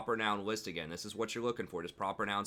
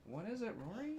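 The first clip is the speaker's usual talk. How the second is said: much higher, soft coaxing; 11 semitones higher, 8 dB quieter than usual. Real, not acted. Dad soothes toddler at desk.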